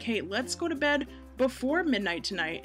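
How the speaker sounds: normal talking voice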